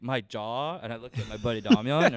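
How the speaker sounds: ordinary delivery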